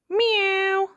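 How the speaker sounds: high kitty meow; very high playful cat cry; Peppa. A narrator's reading. Child playing a witch's cat, meowing high. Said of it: "purred Peppa".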